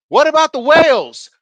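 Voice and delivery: high, loud heckling yell. Retold or imitated. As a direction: yell it out at the presenter from the crowd. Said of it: "This motherfucker yelled out".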